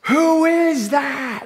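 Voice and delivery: high, slow, drawn-out call; screamed challenge. Retold or imitated. Preacher reenacting screaming into the dark bay, high drawn-out holler. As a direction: holler it across the water, stretching every word.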